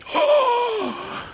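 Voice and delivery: a sharp, high gasp of delight; high mock gasp of delight. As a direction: gasp as if the feast just appeared.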